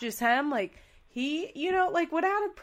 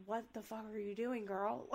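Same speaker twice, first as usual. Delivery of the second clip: unbothered mutter; 11 dB quieter than usual. Retold or imitated. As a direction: toss it off quietly, unfazed. Joe's calm reply to screaming.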